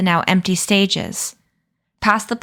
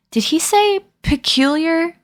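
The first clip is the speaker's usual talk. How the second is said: voiced young girl; 9 semitones higher than usual. A narrator's reading. Bronwyn's voiced surprised question.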